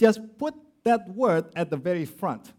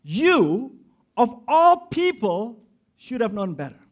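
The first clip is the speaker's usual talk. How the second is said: "You" hit hard and held, then "of all people" slow and louder; stress on YOU; 3 semitones higher, 4 dB louder than usual. Shown as a demonstration. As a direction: punch "You" as the first word. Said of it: "this is how it should have been read".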